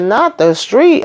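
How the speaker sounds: drawn-out mock disbelief; mock shock. Host reacts to belongings left on street, slow high mock shock. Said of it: "I said".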